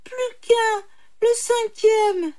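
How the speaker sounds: very high, drawn-out plea; imploring. A narrator's reading. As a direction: plead high and stretch the ending. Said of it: "implora le pauvre homme". (supplication très aiguë et traînante)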